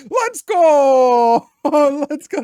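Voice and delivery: high, loud victory shout; excited cheer. Real, not acted. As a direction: cheer like you just won. Celebrating a lucky coin flip.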